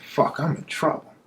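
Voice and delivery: hushed muttered curse, under his breath; a thief cursing to himself as police close in, whispered. Retold or imitated. Thief realizes he's caught.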